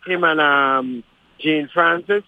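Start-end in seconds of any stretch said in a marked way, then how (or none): none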